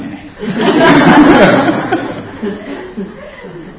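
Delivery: high burst of laughter, trailing down; real laughter at the joke. Real, not acted. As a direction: laugh high and let it fall.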